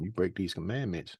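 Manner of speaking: ordinary delivery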